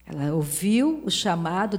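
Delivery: ordinary talk, nothing marked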